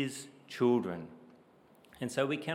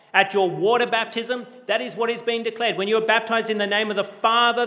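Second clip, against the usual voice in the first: loud, high, fast preaching climb; preaching at full force; 9 semitones higher, 9 dB louder than usual. Acted. Pastor stirring the congregation about baptism, loud preaching. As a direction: lift it into a rousing sermon peak.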